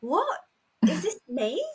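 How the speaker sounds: high, startled questioning; retold incredulous realisation; acted surprise. Retold shock at seeing body.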